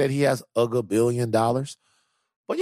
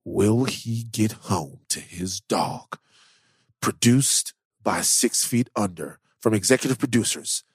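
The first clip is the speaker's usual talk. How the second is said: movie trailer voice. Acted. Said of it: "There it is, Trailer Voice [name]".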